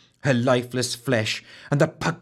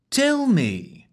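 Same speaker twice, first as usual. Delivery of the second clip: impatient command. A narrator's reading. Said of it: "Quentin commanded impatiently".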